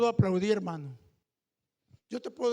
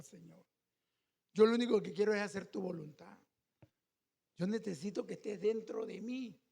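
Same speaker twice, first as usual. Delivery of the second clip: prayer; 4 semitones lower, 3 dB quieter than usual. Acted. Quoted daily prayer, quiet.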